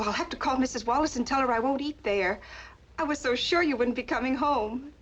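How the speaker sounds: ordinary delivery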